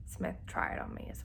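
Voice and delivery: whisper. Real, not acted. Drops to a whisper.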